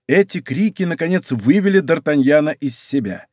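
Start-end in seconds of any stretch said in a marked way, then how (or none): none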